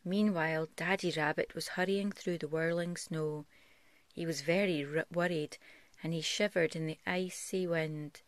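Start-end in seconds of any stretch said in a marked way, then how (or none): none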